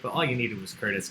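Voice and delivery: ordinary talk, nothing marked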